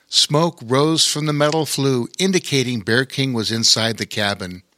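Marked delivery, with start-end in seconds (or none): none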